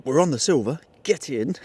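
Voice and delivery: normal talking voice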